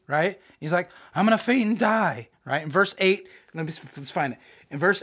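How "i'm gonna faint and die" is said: mock-petulant whine, pitched up; pissy Jonah. Jonah upset over his withered gourd, mock-pissy. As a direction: sulk it out in a higher voice. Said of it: "He gets all pissy".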